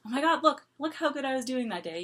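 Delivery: acted-out "oh my God" excitement; imagined amazed reaction. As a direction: exclaim as if impressed by your past self. Imagined future self watching old video.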